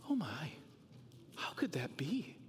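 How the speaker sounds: gasp, then a breathy, soft, shocked whisper; a scandalised tempter, hushed; appalled Satan. Acted. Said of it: "Satan, he is appalled".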